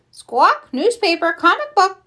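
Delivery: high squawking parrot voice; loud squawk, then high bird mimicry; parrot repeating. A narrator's reading. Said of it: "Polly repeats".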